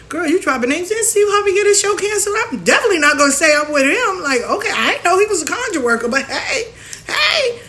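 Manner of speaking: high, fast sassy clapback; sassy mock. Mocking a name-dropping sister.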